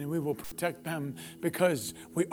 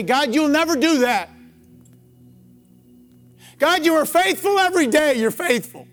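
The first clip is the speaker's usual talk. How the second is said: high, loud, ringing preacher's cry of faith; soaring pulpit prayer, loud and high; impassioned preaching; 9 semitones higher, 12 dB louder than usual. Acted. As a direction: pour the prayer out at full force, building. Preacher voices the prayer at full force.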